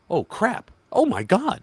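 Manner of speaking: like you just felt the illness vanish; stunned disbelief. Retold or imitated. Recovering patient realizing the fever broke, stunned disbelief. Symptoms suddenly gone, disbelief.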